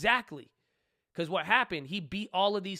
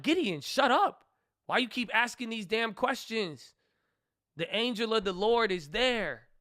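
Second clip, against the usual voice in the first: exasperated outburst; 4 semitones higher than usual. Retold or imitated. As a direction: tell him off like he's driving you mad. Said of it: "I was like".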